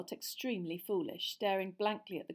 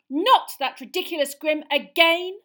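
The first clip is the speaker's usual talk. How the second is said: loud exasperation; 9 semitones higher, 17 dB louder than usual. A narrator's reading. Girl interrupting her teacher, loud and exasperated. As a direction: throw up your hands in exasperation. Hermione outburst.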